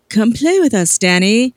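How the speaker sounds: put-on sing-song British ghost-girl invitation. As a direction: coax in a British accent, pausing between phrases. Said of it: "you become English after you die".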